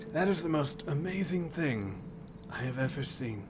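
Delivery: lightly voiced old man; a hint of a frail elder. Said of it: "Where's my old man voice?".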